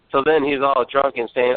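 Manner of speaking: normal talking voice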